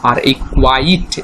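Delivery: normal talking voice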